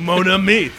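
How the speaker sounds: hammed-up French accent. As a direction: put on a playful French accent. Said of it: "why you got a French accent".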